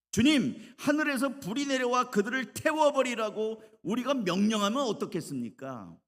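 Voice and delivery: high, loud indignant appeal; angry disciples. Acted. Disciples ask Jesus to send down fire, angry high appeal. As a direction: cry out in outrage to your Lord. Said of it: "화가 났어요".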